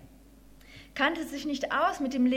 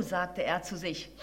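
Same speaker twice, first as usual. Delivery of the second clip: plain reading voice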